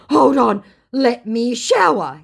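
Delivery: loud, high, drawn-out mock voice; mocked scammer. Scammer stalls her in the chat, mocking. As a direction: announce it with mock importance.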